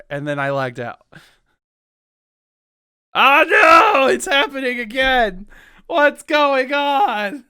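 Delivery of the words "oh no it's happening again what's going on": high, loud mock alarm; mock panic. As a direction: cry out in playful panic.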